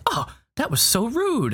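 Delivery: high, fussy offended droid; prissy C-3PO voice. Retold or imitated. C-3PO huffing to the droid who insulted him, high and fussy. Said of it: "And he goes".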